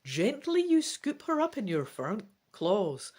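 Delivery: ordinary delivery, nothing marked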